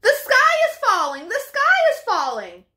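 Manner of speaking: high, loud alarmed squawk; panicked cry. Acted. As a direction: shriek it out in a flap. Chickens keep crying alarm.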